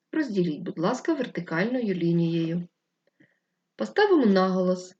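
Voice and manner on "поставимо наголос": ordinary talk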